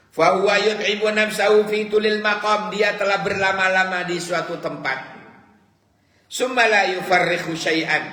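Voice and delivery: high, loud chanted declamation of the Arabic saying; impassioned preaching. Acted. Preacher declaiming the rotten egg proverb to listeners, impassioned and loud. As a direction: cry the proverb out to the congregation.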